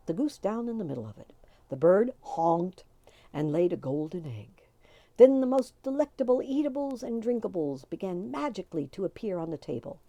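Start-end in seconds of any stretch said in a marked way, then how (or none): none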